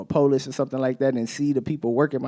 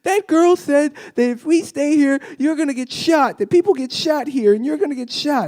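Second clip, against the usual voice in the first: put-on much higher and louder child voice, urgent; frightened child; 12 semitones higher, 5 dB louder than usual. A daughter telling her dad he'll get shot, high frightened child voice. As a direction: blurt it out like a scared kid. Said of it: "she was like".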